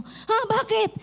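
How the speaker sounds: a sharp, high startled "what, why?"; mock startled cry. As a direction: jolt up in alarm. Retold alarmed reply to leader.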